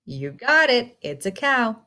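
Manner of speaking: ordinary talk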